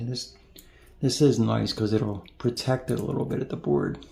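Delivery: usual speaking voice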